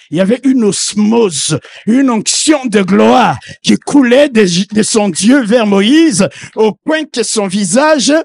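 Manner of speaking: loud, impassioned sermon delivery; fervent preacher calling out; preaching at full force. Acted. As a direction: preach it out to the whole church. Preacher shouting the anointing passage.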